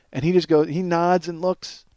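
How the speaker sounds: usual speaking voice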